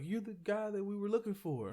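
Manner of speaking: high-pitched voice of a searcher; imitated park ranger. Imitating ranger who found him.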